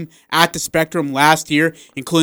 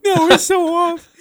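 high, fast through laughter; can't stop laughing; laughing hard; 5 semitones higher, faster than usual. Real, not acted. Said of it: "I'll just lose it".

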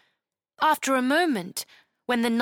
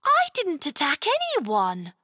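put-on higher girl voice, protesting; Chloe protesting; 6 semitones higher than usual. Chloe denying to the knight, a child protesting. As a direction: deny it indignantly as a child.

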